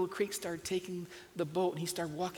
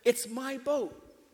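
proud naming; 8 semitones higher, 5 dB louder, much slower than usual. Retold or imitated. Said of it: "and he said".

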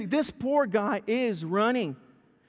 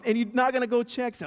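ordinary talk

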